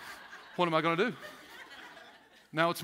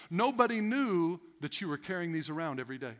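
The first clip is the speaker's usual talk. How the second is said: ordinary talk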